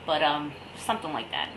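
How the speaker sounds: ordinary talk, nothing marked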